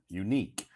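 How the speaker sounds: unique said clearly as you-NEEK, stress on the second syllable; unique demo. Shown as a demonstration. Teacher demonstrating unique for live-stream viewers. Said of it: "teach us the pronunciation of unique".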